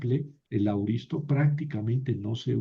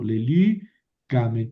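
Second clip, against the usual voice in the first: lelícamen slowly, stressed on the second syllable LÍ; le-LÍÍ-ca-men, demonstrating the shifted accent; moved stress; slower than usual. Shown as a demonstration. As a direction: draw out LÍ in lelícamen. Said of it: "tengo que mover el acento".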